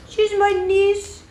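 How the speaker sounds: squeaky pet voice, drawn out. Real, not acted. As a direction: gush "She's my niece" at the dog in a high sing-song. Cooing the dog introduction.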